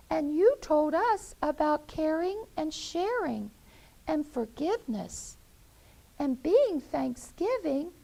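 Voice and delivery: put-on high childlike pleading voices; little chicks. Chicks asking their mother to forgive her friends. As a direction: plead sweetly like small chicks. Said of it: "Her little chicks looked at her and said".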